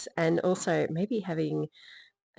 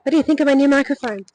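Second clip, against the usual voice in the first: louder and higher, calling out; 9 semitones higher, 14 dB louder, much faster than usual. Shown as a demonstration. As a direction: call it out across the room. Repeats it loudly to pet.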